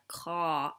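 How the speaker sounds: slow, drawn-out "car", open ah; American open vowel. Shown as a demonstration. Vlogger showing the vowel in coffee, drawn-out "car". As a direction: hold the "car" vowel long, American style. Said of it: "you have to do, like, the O".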